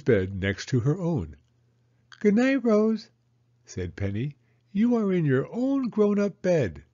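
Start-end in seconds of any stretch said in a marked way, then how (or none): 2.2-3.1 put-on high, eager child's voice; little girl Penny. Penny asking Mama about snow, high childlike voice. Penny says good night to Rose. 4.7-6.8 put-on high, eager child's voice; little girl Penny. Penny asking Mama about snow, high childlike voice.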